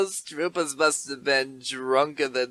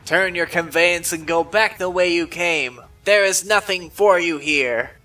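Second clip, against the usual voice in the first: loud, slow rough warning; loud, slow shouted warning; shouting guard; 2 semitones higher, 7 dB louder than usual. Acted. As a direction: bellow them off the land.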